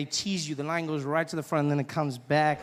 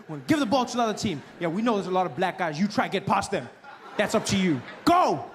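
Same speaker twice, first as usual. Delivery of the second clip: mock coach. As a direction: yell the rules at the players like a coach. Coach voiced addressing American players.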